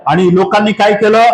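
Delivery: ordinary talk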